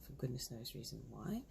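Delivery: ordinary talk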